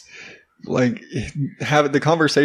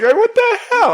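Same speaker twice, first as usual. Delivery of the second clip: very high, squeaky what the hell; mock disbelief; 5 dB louder than usual. Dad retelling his own shocked reaction, squeaky. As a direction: blurt it in shocked falsetto. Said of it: "I'm just sitting there like".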